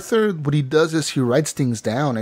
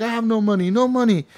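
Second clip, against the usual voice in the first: higher, whiny mock plea; pleading debtor; 9 semitones higher than usual. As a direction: beg like a broke man caught out. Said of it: "And he's like".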